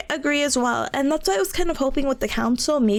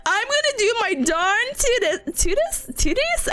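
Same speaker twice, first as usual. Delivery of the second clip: a hint of a cowboy twang, slowed and higher; fumbling a cowboy drawl on "tootin'"; half-hearted cowboy voice tripping over "darn tootest"; mock cowboy; 8 semitones higher than usual. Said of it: "trying to be cowboy".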